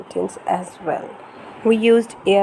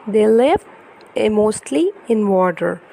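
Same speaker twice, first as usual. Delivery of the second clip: put-on lively, sing-song storybook reading for a toddler; reading to children; 3 semitones higher, 3 dB louder, slower than usual. Parent reading an ocean picture book to baby, animated storytelling. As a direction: read it brightly to hold a little one's attention.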